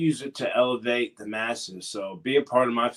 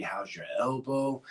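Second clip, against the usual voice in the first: soft-spoken imitation of a colleague; put-on voice of a gentle acquaintance; imitating his talk; 5 dB quieter than usual. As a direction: do his odd voice, a bit quieter. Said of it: "Talking like this".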